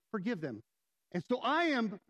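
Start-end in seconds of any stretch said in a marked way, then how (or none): 0.1-0.6 softly lowered, gentle urging; imitating a gentle inner voice. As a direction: say it low and gently, without blame. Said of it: "and gently his word says".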